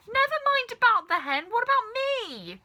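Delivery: jealous cry for attention; Grandma shouting. A narrator's reading. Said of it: "Grandma shouted".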